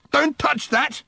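high, sharp yell. A narrator's reading. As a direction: yell to stop his hand. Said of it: "yelled Ford".

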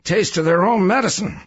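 bitter gloating passenger, pitch rising; sardonic, emphatic satisfaction; bitter chuckling. A narrator's reading. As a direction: gloat with sour relish. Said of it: "chuckled bitterly".

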